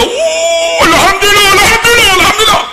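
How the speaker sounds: high, loud tearful alhamdulillah; tearful thanks. Retold or imitated. The old man, near tears, thanks God on learning where he prayed. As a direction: voice cracking with grateful tears. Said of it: "Mau nangis dia".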